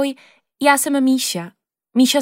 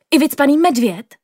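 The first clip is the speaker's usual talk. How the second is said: high, louder surprised squeak; a girl yelps at news of a stuffed bear; yelp of surprise; 6 semitones higher, 4 dB louder than usual. Acted. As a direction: blurt it out in sudden excitement. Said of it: "vyjekla jsem".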